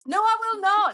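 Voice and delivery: high, loud indignant no to the app; mock indignant refusal. Dater mock-defying her dating app, high and loud. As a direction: refuse the app like a stroppy child.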